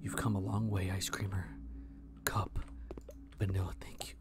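hushed, very soft pleading; whispering. Real, not acted. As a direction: keep it barely above a breath. Whispering through creepy level.

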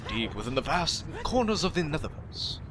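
a lightly voiced grand movie-trailer narrator; epic trailer narrator. Gamer narrating the temple as a mock-epic trailer narrator. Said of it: "I can't do that voice very well".

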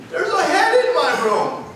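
put-on very high little-girl voice; imitated little daughter. Four-year-old telling dad about a head, imitated child voice. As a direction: mimic a small child reporting a night-time scare. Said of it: "my daughter comes into my room and goes".

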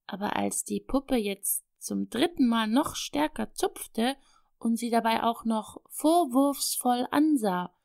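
ordinary delivery, nothing marked

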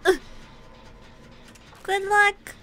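ordinary delivery

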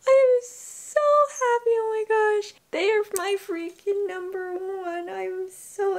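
high, loud, tearful cry of joy; overjoyed, voice breaking into tears; happy crying. Real, not acted. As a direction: burst out crying with happiness. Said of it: "I'm trying not to cry right now".

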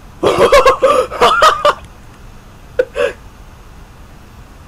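long burst of high-pitched laughter; loud high laugh. Real, not acted. Gamer laughing after a mishap, loud cackle.

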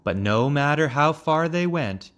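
plain reading voice

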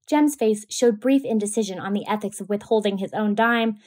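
ordinary delivery, nothing marked

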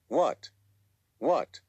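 sharp, high startled question; jolted back to the room. Acted. Wolf suddenly noticing his friend calling him, startled high. Snapped out of panic.

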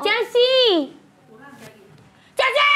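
high-pitched calling shout. Acted. Boarding-house owner summons a helper, shouting. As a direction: yell the name to summon someone. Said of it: "Apaan sih teriak-teriak?".

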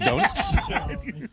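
ordinary delivery

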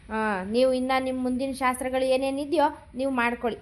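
ordinary delivery

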